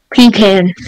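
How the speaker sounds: normal talking voice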